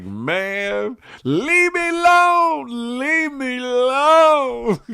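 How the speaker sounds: high, slow drawn-out whine; imitating a whiny angry kid. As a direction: stretch it out like a fed-up child. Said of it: "I was pissed".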